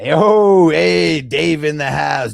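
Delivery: loud shouted hype greeting. Real, not acted. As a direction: call it out across the room. Shouting greeting to viewer Dave.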